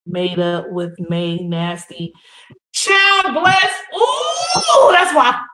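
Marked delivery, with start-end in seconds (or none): 2.7-5.5 loud, thrilled whoop that carries into the line; hyped live-stream shout; excited shout. Real, not acted. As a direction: cheer the room on. Hyping up the live chat.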